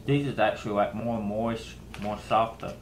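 talking with food in mouth; speaking between bites; chewing while talking. Real, not acted. Seen 1: Bit chip at -2.0; jaw working, chip in hand while talking.

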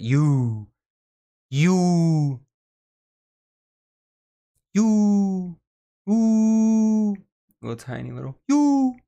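high, loud squealing coo. Real, not acted. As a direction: gush over something tiny and cute. Gushing at cute avatar.

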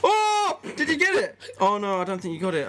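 loud, high excited reaction. Real, not acted. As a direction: shout it out in surprise. Reacting to brother's pick.

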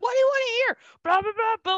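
high, loud excited listing; rapid-fire movie nerd. Retold or imitated. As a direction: squeal out the questions like an eager fangirl. Mimicking a rattling-off character.

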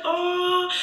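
high-pitched mock sympathetic coo; drawn-out, high imitation of sympathy; cooing doctor. Mimicking the unwelcome sympathetic doctor.

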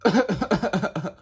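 slow cracking croak; strained cracking voice. Real, not acted. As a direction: push sound through a lost voice. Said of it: "I can't talk very well".

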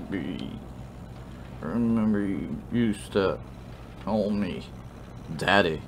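put-on slurred, halting drunk reminiscing; sloppy drunk, losing the thread. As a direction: ramble tipsily with long gaps between words. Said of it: "You got sloppy ass drunk".

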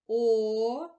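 a loud, drawn-out О on its own; sound the vowel alone. Shown as a demonstration. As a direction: call out О clearly as a single sound. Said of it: "назвемо всі звуки". (протяжно й голосно звук О)

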